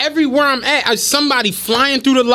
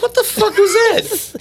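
shocked exclamation; 9 semitones higher, slower than usual. Retold or imitated. Retelling shock at donuts.

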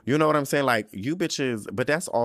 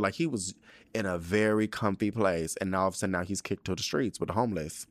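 ordinary talk, nothing marked

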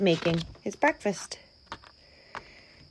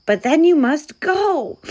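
high, louder, tearful protest; weepy bear still trying to be firm; sniffly insistence; 8 semitones higher, 6 dB louder than usual. A narrator's reading. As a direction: insist through mock sniffles. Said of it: "he sniffled".

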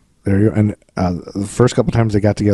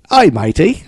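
mock Australian accent; 5 semitones higher than usual. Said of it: "No, that's Australian".

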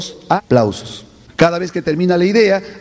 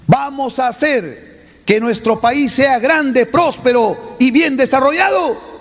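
rousing speech; 5 semitones higher than usual. Acted. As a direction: fire up the audience. Orator questions audience.

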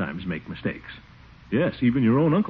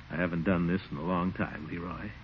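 tender; 4 semitones lower, 8 dB quieter than usual. Acted. Uncle tender with boy at bedtime.

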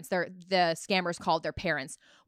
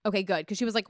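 ordinary talk

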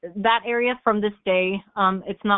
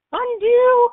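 panicked mock plea; 5 dB louder than usual. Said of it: "and I'm like".

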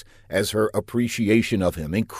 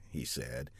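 ordinary delivery

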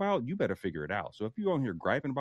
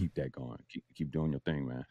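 deep man voice; 9 semitones lower, 4 dB quieter than usual. Acted. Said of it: "Sorry for the performance".

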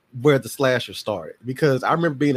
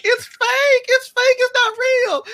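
high, loud squealed reassurance; hammed-up high-pitched mom yell; panicked mom; 5 dB louder, slower than usual. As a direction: imitate a frantic mother shouting over screams. Mom calming screaming child.

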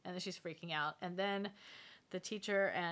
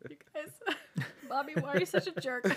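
laughing exasperation; 7 semitones higher, 3 dB louder than usual. Retold or imitated. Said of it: "be like".

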